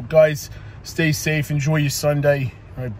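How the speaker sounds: ordinary talk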